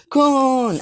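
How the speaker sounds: very high, loud joyful cry of the name; excited shout. Acted. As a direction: yell the name in delight as you jump on him. Character leaps on Kun greeting.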